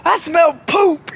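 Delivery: high, slow complaint; mock disgust. People in the crowd saying it, mock disgusted. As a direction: imitate a bystander drawing out the awful discovery. Crowd member noticing stench.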